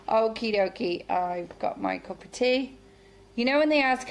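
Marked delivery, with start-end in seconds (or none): none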